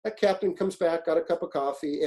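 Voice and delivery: usual speaking voice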